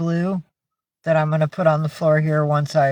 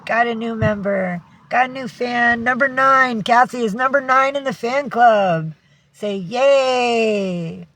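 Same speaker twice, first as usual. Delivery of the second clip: bright, higher cheer for a new fan; excited squeal of welcome; 6 semitones higher, slower than usual. Real, not acted. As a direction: celebrate the new member, voice lifted high.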